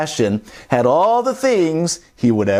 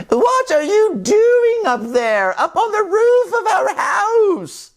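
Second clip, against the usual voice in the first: put-on very high, slow old-lady call; calling sisters; slower than usual. Three sisters calling up to the roof, very high. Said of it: "Three sisters called out".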